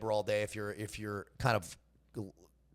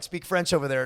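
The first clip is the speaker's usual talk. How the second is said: milder raised-voice retelling of a yell; imitated yelling; 5 semitones higher, 5 dB louder than usual. Translating the locals' demand, mildly yelled. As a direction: call it out, a bit higher and louder. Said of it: "They'd be yelling at me".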